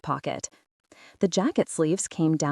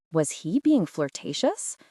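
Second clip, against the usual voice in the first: ordinary delivery, nothing marked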